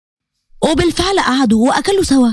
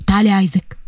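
low, stern command from the professor; 6 semitones lower than usual. Acted. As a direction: call her over coldly, lower. Stern professor calls her over.

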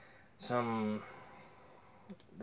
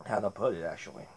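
chewing between words; talking while chewing; 4 semitones higher, 3 dB quieter, faster than usual. Real, not acted. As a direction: mouth partly full. Seen 0: Holds thin snack stick at lips, mouth working while tasting.

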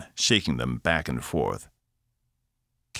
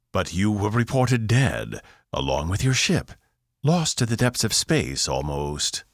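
priestess voice, a little higher and slower; haughty alien priestess; 5 semitones higher than usual. A narrator's reading. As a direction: speak down from the throne, doubtful.

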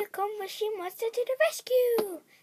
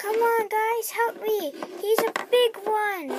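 yelling for help; 3 semitones higher, 9 dB louder than usual. Acted. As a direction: shout to the team for help. Calling for help in fight.

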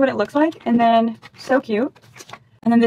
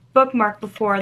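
ordinary talk, nothing marked

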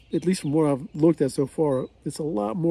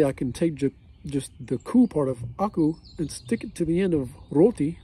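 ordinary talk

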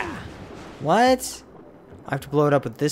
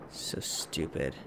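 muttered under breath; 11 semitones lower, 12 dB quieter, slower than usual. Real, not acted. Frustrated muttering at controls.

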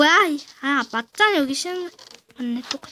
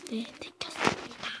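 whispering; 5 semitones lower, 16 dB quieter than usual. Real, not acted. Mock confession aside.

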